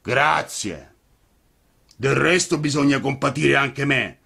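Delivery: booming puppet master; gruff puppeteer. A narrator's reading. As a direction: play a softhearted blustering giant. Mangiafuoco's voice in dialogue.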